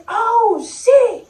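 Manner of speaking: high excited onlooker voice; mock crowd hype, high pitched; hyping onlooker. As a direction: egg the fight on like a bystander. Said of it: "all I hear is".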